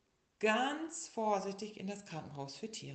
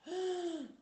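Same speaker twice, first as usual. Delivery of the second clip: deep breath in; 13 semitones higher than usual. A narrator's reading. Little tiger breathing in for the doctor, gasp. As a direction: suck in air for the doctor. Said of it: "machte der kleine Tiger".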